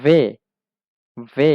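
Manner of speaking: "ভে" said veh, with a v; German W modelled as "ভে"; German letter W. Shown as a demonstration. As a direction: drill "ভে" as one clear syllable. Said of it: "জার্মানে সেটা ভে pronunciation হয়".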